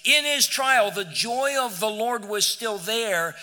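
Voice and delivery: loud, high, driving pulpit voice; rising revival fervor; full-force preaching. Acted. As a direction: preach it hard to the back row.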